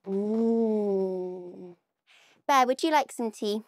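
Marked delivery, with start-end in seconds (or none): 0.1-1.8 a contented tasting hum; happy tummy-rubbing mmm; yummy mm. Shown as a demonstration. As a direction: hum as if the jam tastes delicious. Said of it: "you can rub your tummy and you can go".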